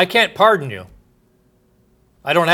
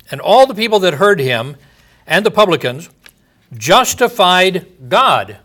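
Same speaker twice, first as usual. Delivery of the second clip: scripture reading; 4 semitones higher, 5 dB louder than usual. Acted. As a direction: proclaim the verse, stretching the last words.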